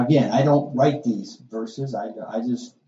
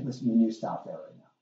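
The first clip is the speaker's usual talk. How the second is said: soft, brisk, firm parental order; calm demand; 3 semitones lower, 9 dB quieter, faster than usual. Shown as a demonstration. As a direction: tell the child off without raising your voice. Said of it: "You talk in a very calm".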